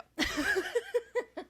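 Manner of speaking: high cackling laugh; witch cackle. Pretend. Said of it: "Sound like a witch".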